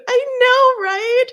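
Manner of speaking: high, loud gushing squeal; real squeal of delight over the cute plushie; squealing excitement. Real, not acted. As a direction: gush over how cute it is. Squealing agreement over cute toy.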